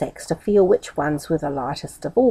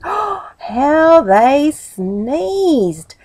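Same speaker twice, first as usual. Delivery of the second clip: ordinary delivery, nothing marked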